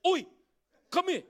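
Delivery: loud, high, cheeky call Oi come here; mock-casual God shouting for Moses; rough casual call. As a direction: holler like a mate across a paddock. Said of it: "Did he say".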